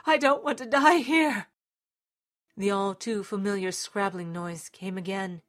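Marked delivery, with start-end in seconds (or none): 0.0-1.5 high, loud desperate plea; crying out. A narrator's reading. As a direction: cry out into the dark, begging. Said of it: "he cried out to the darkness".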